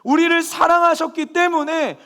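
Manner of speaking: loud, high fervent preaching; impassioned sermon climax, loud and high; full-force preaching. Acted. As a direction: preach it out to the congregation.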